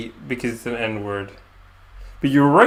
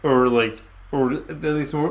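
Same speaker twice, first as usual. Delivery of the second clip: ordinary talk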